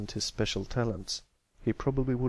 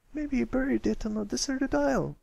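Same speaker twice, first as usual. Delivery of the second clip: put-on young filly voice; 14 semitones higher than usual. Filly guesses in story.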